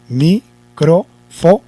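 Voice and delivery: "Micrófono" as mi, cro, fo, no with long pauses; syllable by syllable. Shown as a demonstration. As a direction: count out Micrófono slowly by syllables. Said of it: "la última sílaba dice no". (Micrófono sílaba por sílaba, muy lento)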